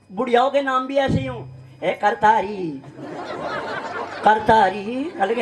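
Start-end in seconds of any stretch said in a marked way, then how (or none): 1.8-5.1 mock old woman's low, drawn-out call; बुढ़िया की पुकार. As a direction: imitate a granny slowly hailing her friend. Old woman calls her friend Kartari. (बुढ़िया की धीमी, नीची पुकार की नकल)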